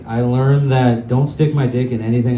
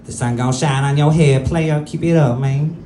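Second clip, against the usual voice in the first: lightly pitched-up hype man; a hint of Cat Williams cheering you on; Cat Williams impression; 3 semitones higher than usual. Inner narrator after a hard day, lightly voiced Cat Williams. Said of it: "he's like".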